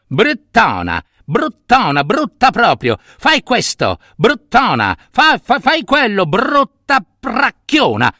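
voce acuta da principino che comanda; reuccio prepotente, sprezzante. Acted. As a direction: insulta e comanda con capriccio. (reuccio viziato e dispettoso)

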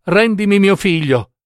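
tearful pleading. A narrator's reading. Mother begs Death for her son, pleading. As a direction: plead for it, mildly anguished. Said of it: "supplicò la madre piangendo".

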